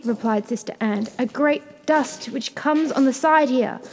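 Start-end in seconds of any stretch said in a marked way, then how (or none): 1.2-3.8 calling down. A narrator's reading. Sister reports from the tower.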